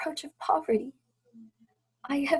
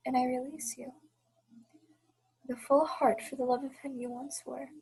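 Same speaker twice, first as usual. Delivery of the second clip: normal talking voice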